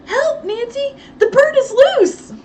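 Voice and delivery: high, loud, slowed panicked cry; shrill cry for help. Acted. Housekeeper crying out to Nancy, shrill panic. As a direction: scream it out in alarm. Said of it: "Effie's shrill cry".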